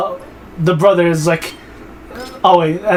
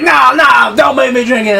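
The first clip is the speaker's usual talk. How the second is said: high, loud, hammed-up begging; mock terrified plea; 7 semitones higher, 5 dB louder than usual. King forced to drink poison, mock desperate plea. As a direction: cry out in exaggerated protest.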